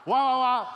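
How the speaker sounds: very loud, high, rousing wahs; shouted "wah" noise. Shown as a demonstration. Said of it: "One more time".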